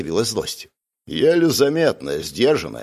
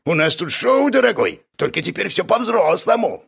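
high, gleeful showman; excited, pitched-up relish; joyful; 10 semitones higher than usual. A narrator's reading. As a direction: announce it like a show host. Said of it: "радостно кивнул Гиджин".

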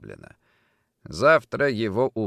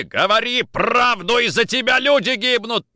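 high, loud furious shout; enraged demand; 13 semitones higher, 6 dB louder than usual. Acted. Nikitin choking Orlov for the truth, enraged demand. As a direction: shout for the truth, leaning on the words.

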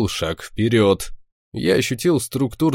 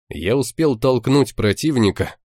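plain reading voice

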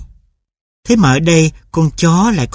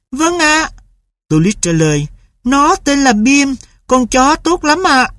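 put-on much higher, earnest child; boy's pleading voice; 10 semitones higher than usual. A narrator's reading. Boy tells mother the dog is good, much higher. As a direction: vouch for the dog politely.

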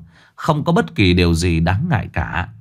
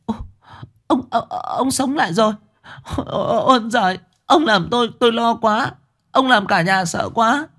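high, whimpering half-sob; wife crying with relief; tearful; 13 semitones higher than usual. Acted. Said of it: "nói như mếu". (giọng mếu máo, nghẹn ngào mừng rỡ)

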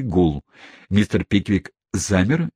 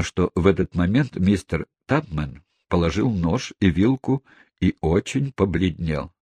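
plain reading voice

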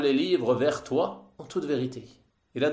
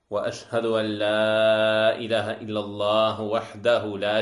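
Arabic sermon invocation; 2 semitones lower, faster than usual. Acted. Opening a lesson on a creed poem, formal recited invocation. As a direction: intone it evenly, lingering on the long vowels.

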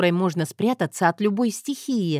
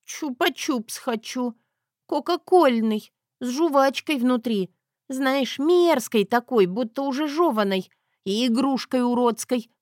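whiny Zoya; 6 semitones higher than usual. Acted. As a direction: pester him childishly.

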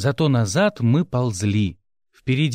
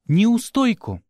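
startled echo; 8 semitones higher, slower than usual. A narrator's reading. Boss startled by demand for compensation.

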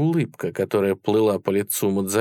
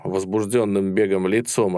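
plain reading voice

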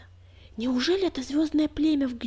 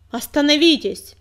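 commanding cry to stop; loud shout; 4 semitones higher, 11 dB louder than usual. A narrator's reading. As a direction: call out over the brawl. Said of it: "громко крикнула".

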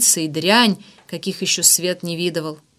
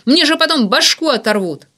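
high, loud, frantic protest; furious panic; 7 semitones higher, 5 dB louder than usual. A narrator's reading. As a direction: lash out, desperate to stop him. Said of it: "разъярённым псом кидаясь на меня". (яростно и отчаянно, на высоких тонах)

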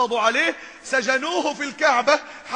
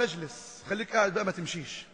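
much softer, a quiet fatherly request to sit; gentle hushed command; 4 semitones lower, 7 dB quieter than usual. Retold or imitated. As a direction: settle him down softly, almost under the breath. Prophet gently seats Umar. (بصوت خافت وحنون)

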